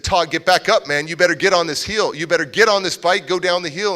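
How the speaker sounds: loud, high bullying shout; retold yell. A kid yelling at his bleeding neighbor Todd to get back up, loud shout. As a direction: bark the orders down at him from the hill.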